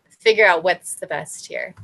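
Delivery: ordinary talk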